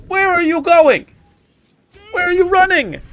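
high-pitched mock outrage; calling after them. Player to fleeing enemies, high indignant call.

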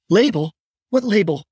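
high, frightened question; frightened confusion. Acted. Patricia to her kidnapper, scared and confused.

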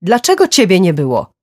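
heated, high reproach with a falling close; angry accusation. A narrator's reading. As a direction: throw the blame hard. Angry accusation in a parents' quarrel.

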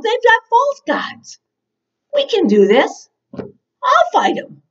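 put-on high, earnest young-hero plea; eager rallying. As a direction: urge them on with bold confidence. Acting David rallying his brothers.

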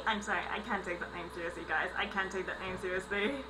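ordinary talk

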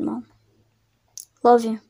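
ordinary delivery

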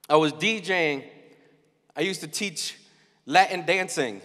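ordinary talk, nothing marked

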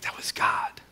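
unvoiced-breath whisper, very soft; acted whisper of recognizing God; hushed realization. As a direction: whisper it in quiet wonder. Awed reaction to still small voice.

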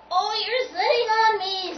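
high, loud squeal of complaint; protesting little tune. Acted. Little tune squashed by Tubby, high loud protest.

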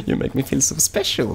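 high, sugary fake gratitude; mock a sappy YouTuber thanking fans; mock gushing YouTuber. Mocking typical YouTubers thanking viewers, high gushing voice. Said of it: "like every typical YouTuber would be like".

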